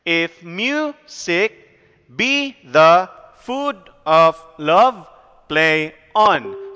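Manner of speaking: slow, measured da-DUM beat on "If music be the food of love, play on"; teacher's exaggerated iambic scansion of "If music be the food of love, play on". Shown as a demonstration. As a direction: pause between feet, leaning hard on MU, BE, FOOD, LOVE and ON. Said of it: "That's how you read that".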